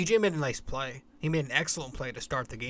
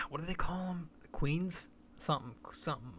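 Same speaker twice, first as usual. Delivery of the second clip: whispered aside; 2 semitones higher, 6 dB quieter than usual. Real, not acted. Searching for term aside.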